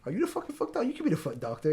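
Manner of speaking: high-pitched mock New Yorker demanding answers; heavy New York accent. Angry mother confronts the security guy, New York accent. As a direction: imitate a cursing New York woman. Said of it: "she had a really heavy New York accent".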